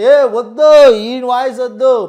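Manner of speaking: loud, high yell, slow on the last words; angry heckler shout. Retold or imitated. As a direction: shout them down from the crowd. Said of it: "ఈ voice వద్దని చెప్పి అరవడం".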